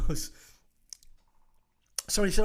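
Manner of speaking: normal talking voice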